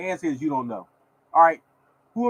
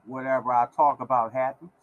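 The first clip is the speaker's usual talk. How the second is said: ordinary talk, nothing marked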